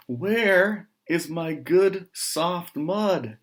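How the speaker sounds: high, loud, slow plaintive pig voice; upset small pig. Acted. Small pig asking the farmer's wife where his mud went, plaintive. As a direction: wail it like a bewildered little pig.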